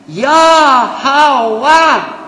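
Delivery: loud, high, drawn-out invocation; calling out. Shown as a demonstration. Preacher calling upon the living God, calling out. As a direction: hail God with each syllable held. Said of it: "How do you call upon somebody?".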